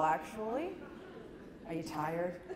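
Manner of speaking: ordinary talk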